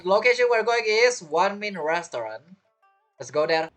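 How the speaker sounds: ordinary delivery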